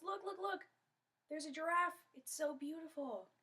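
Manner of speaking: high, excited pointing-out; excited calling out. Acted. Kondoma signals to her friend, excited calling out. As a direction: share a thrilling sight with a friend. Spots giraffe, calls to friend.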